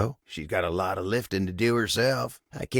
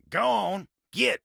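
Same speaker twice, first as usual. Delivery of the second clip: high, sharp dismissal; pitched-up, irritated send-off; shooing away; 12 semitones higher than usual. Acted. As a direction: shoo them off in a raised pitch. Shoos the visitor away.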